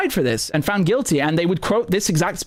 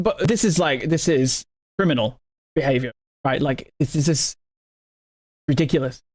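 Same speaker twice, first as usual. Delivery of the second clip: normal talking voice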